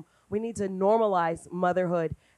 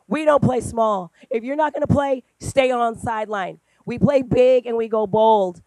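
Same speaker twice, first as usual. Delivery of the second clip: firm pep talk; 4 semitones higher, 10 dB louder than usual. Retold or imitated.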